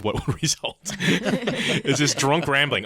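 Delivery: laughing. Real, not acted. Laughing at the book.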